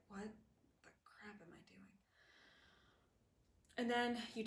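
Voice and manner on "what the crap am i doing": whispered, barely audible; hushed self-reproach. Acted. Sobered up after texting him back, hushed self-reproach. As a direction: whisper it to yourself in dismay. Retelling a sober moment of regret.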